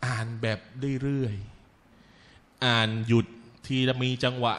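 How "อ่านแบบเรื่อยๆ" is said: "เรื่อยๆ" stretched out long and low; unhurried, drawn out. Shown as a demonstration. Preacher showing how the Prophet recited without rushing, slow and drawn out. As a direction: draw out "เรื่อยๆ" to show leisurely reading. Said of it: "คนไม่ได้อ่านแบบเร็ว".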